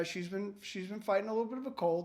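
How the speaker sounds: usual speaking voice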